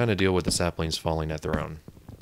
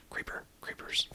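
hushed breathless whisper; scared whisper; 12 dB quieter than usual. Real, not acted. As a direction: whisper so the creepers can't hear. Spots creepers, whispers.

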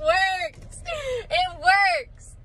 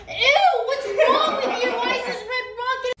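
high laughing disgust; 9 semitones higher, 3 dB louder than usual. Real, not acted. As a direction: squeal it grossed out. Disgust at prank.

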